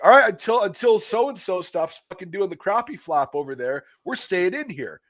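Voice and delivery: high, loud scold held to the end; drill sergeant scolding. Retold or imitated. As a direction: yell at the whole room like a sergeant. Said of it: "And then they're like".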